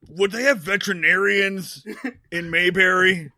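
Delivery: loud, badly faked superhero voice; bad Batman. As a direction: put on a hammy Batman voice, dragging the last word. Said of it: "That was a really bad Batman voice".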